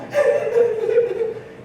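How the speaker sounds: high-pitched mock giggle; imitated toddler giggle. Dad watching son in the mirror, imitated gleeful giggle. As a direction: giggle like a delighted little boy. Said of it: "'cause he all".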